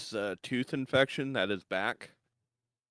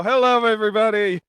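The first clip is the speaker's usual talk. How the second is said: laughing; 13 semitones higher, 14 dB louder than usual. Real, not acted. Greeting while still laughing.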